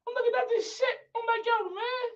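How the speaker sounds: high, soft disbelieving voice; lightly voiced shock; stunned. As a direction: say it dazed and squeaky. Retelling stunned reaction.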